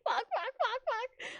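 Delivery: high panicked squeak, unvoiced; panicked whisper-squeak. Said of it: "I'm like".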